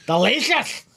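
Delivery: mock German, like an angry man sneezing; bad German accent. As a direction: sputter it out in a terrible German accent. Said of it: "my German accent is like Adam Sandler".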